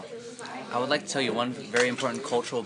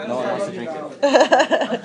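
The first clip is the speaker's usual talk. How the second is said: laughing; 12 semitones higher, 6 dB louder, slower than usual. Real, not acted. Host laughs, drawn out.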